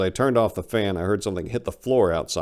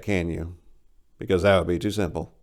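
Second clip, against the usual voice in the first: plain reading voice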